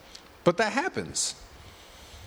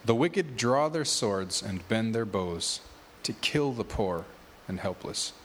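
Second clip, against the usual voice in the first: lower, steady Bible reading voice; scripture reading; 5 semitones lower than usual. Acted. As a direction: read the psalm to the congregation. Reads scripture chapter aloud.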